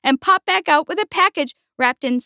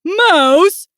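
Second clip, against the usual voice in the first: higher, louder prompting call; 5 semitones higher, 5 dB louder than usual. A narrator's reading. Bear prompts distracted Mouse, calling out. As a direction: call for attention.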